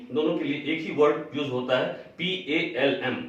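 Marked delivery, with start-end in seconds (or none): none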